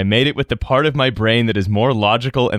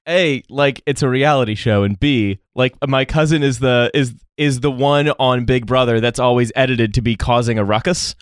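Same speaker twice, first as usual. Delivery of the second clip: ordinary talk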